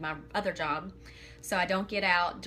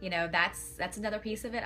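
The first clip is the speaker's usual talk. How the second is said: ordinary talk, nothing marked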